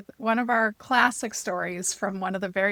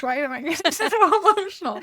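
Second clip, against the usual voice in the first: laughing through emotion; 5 semitones higher, 4 dB louder than usual. Real, not acted. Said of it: "I'm gonna get emotional".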